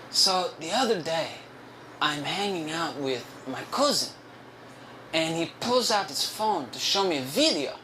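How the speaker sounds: halting, singsong coworker impression; put-on confiding voice, doled out in pieces; Ricardo. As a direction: imitate him with long pauses and swooping stresses. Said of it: "I'm gonna do his voice".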